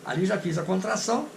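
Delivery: ordinary talk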